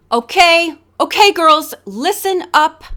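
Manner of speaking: loud and high, calling the room to order; shouting for attention. A narrator's reading. Organizer calls models to line up, loud shout. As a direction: clap the group to order with a shout. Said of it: "clapping her hands and shouting to get".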